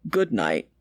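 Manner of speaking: a low fatherly goodnight; father's voice. A narrator's reading. Father answers daughter goodnight.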